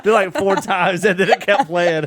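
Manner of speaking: loud, high and laughing. Real, not acted. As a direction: tell it while cracking up. Laughing while telling glitch.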